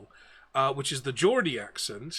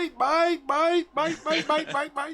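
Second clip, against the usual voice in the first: squawking seagulls; 12 semitones higher, slower than usual. Pretend. Joker mimicking a flock overhead, seagull squawk. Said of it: "There's a flock of seagulls circle overhead".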